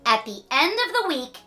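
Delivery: usual speaking voice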